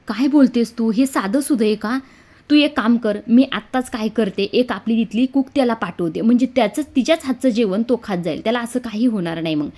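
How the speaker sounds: loud, agitated, taking charge over the phone; an anxious sister raising her voice; upset and worried. Acted. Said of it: "Shilpa फार चिडते". (काळजीने चिडून, मोठ्या आवाजात)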